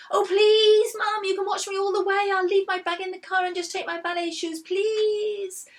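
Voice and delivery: put-on high, loud begging child; pleading girl. A narrator's reading. Poppy begging Mum.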